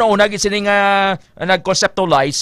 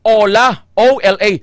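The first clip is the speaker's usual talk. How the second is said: usual speaking voice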